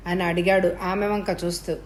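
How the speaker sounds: plain reading voice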